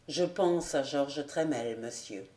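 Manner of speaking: low, fading almost to nothing; sad admission. Acted. Old squire sadly confesses he thinks of lost Georges, fading quietly. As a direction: let the answer sink into grief.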